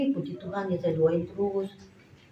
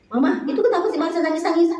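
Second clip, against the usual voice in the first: high, louder imitated questioning; puzzled husband; 10 semitones higher, 5 dB louder than usual. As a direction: ask in pitched-up bewilderment. Husband puzzled by child's crying.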